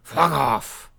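punched, gruff patriarch impression; Logan Roy. Shown as a demonstration. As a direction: punch it out as a curt TV tyrant. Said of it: "It's just".

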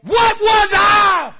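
high, drawn-out full shout; exasperated self-questioning. Acted. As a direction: holler it slowly at the top of your voice. Repeats the acted self-questioning shout.

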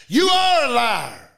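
forceful rebuke. Retold or imitated. Preacher telling the devil off, forceful rebuke. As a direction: hit it hard and stretch it out.

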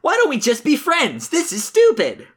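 high, whiny plea; Sonic, exasperated. Acted. Sonic begs the trolls to be friends, high and exasperated. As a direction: plead in a high, frustrated hero voice.

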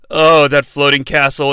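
ordinary talk

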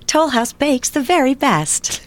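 slow sweet commercial voiceover; voiceover tagline. Shown as a demonstration. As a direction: sell the cookie like the commercial's closing line. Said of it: "it was my voice at the end going".

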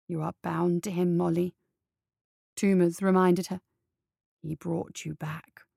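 a hint of a grave warning; low stern head. Head reminds her of duty.

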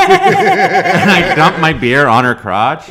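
slow, loud laughing punchline; cracking up while telling it. Real, not acted. Friend laughing through the story's punchline.

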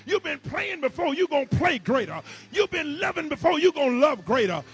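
loud, rising preacher's call; climbing sermon fire; full-force preaching. Acted. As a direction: build the crowd up and shout it. Preaching climax, call and response.

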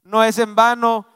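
loud, slowed "Tu sufrimiento no es en vano"; preaching call-and-response. Real, not acted. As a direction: lead the congregation to repeat it. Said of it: "dígale". (predicación fuerte, lenta, para que la iglesia repita)

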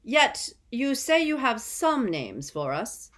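loud, slow, high-pitched challenge; stern official. A narrator's reading. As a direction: press him coldly. Crouch presses the prisoner.

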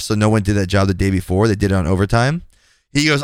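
ordinary talk, nothing marked

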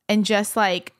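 normal talking voice

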